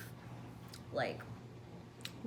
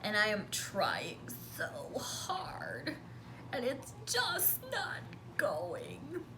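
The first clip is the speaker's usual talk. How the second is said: strained whisper; 5 semitones higher, 3 dB quieter, slower than usual. Retold or imitated. Straining to cut leather.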